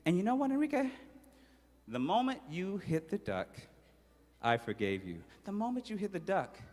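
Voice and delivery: soft, gentle forgiveness; tender mother. Acted. Mother gently forgiving her son, soft and tender. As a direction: speak softly like a loving mom.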